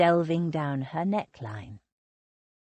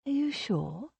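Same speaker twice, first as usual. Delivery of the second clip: hushed, softer question; low voice; 6 semitones higher, 6 dB quieter than usual. A narrator's reading. Sylvia quietly asking Victor about the message, in a low voice. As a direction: ask it low so Lily won't hear. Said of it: "she asked in a low voice".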